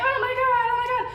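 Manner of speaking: high, hammed-up mock agony; stabbed Hamlet. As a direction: wail in comic shock at being stabbed.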